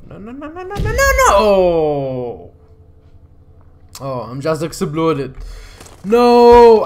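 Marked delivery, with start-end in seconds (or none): 0.1-2.5 panicked no shout. Real, not acted. Character about to explode. 6.0-6.8 sharp loud cry of no; frustrated shout. Real, not acted. Character just exploded.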